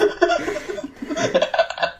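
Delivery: breathy, high laugh. Real, not acted. Storyteller laughs at the scene he retold. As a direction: let the laugh trail off.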